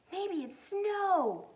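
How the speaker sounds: high, slow wonder; breathless delight at the glow; excited. Acted. Corduroy sees the glowing soap, excited and high. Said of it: "he said excitedly".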